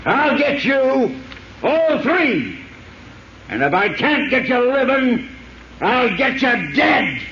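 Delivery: high, wild vengeful threat; raving threat. Acted. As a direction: scream revenge as they drag you. Prisoner's final raving threat.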